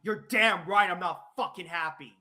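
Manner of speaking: furious, loud and biting; real angry rant at a caller. Real, not acted. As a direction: let the anger spill out hard. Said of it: "warning, he's not happy".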